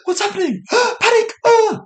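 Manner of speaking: hammed-up fright at the quake; mock panic. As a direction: act out sudden terror with gasps. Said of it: "I was like".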